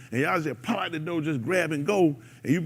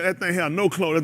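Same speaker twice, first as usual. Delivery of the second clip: ordinary talk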